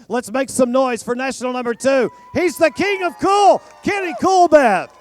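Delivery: big arena hype call over music; booming pumped announcer. Acted. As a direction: pump up the crowd. Arena announcer hyping the winner.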